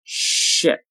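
"Ship" with a long held sh; slow careful "Ship"; drawn-out model. Shown as a demonstration. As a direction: stretch "Ship" out for learners. Teacher models word slowly, then normally.

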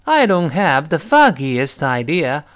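slow English, rising on the closing question clause; model sentence reading. Shown as a demonstration. Teacher repeats the example for students, slow model reading. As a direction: read it slowly and clearly for learners. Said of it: "是这么来说啊".

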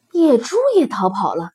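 ordinary delivery, nothing marked

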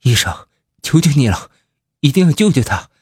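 desperate begging, pitch rising; pleading. A narrator's reading. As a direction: beg the doctor to save her. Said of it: "铁面判官哀求着".

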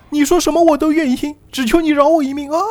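high-pitched tearful damsel wail; sob-talk in a put-on woman's voice; crying while pleading. Woman begs captors to spare her, crying. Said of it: "啊，一边哭一边说".

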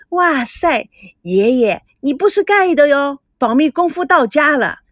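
high, bright-eyed squeal of delight; a girl cheering loud and high for the good news; excited girl. A narrator's reading. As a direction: cheer like a thrilled little granddaughter.

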